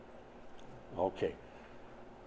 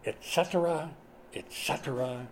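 lightly voiced regal Yul Brynner, falling on each et cetera; a hint of the King of Siam, trailing down; Yul Brynner impression; 3 semitones lower, 7 dB quieter than usual. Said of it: "let me try and do it in my best Yul Brynner voice".

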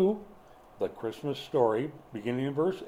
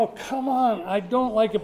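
exasperated grumble; 7 semitones higher than usual. Retold or imitated. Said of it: "I get, I go".

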